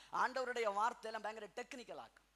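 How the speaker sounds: ordinary delivery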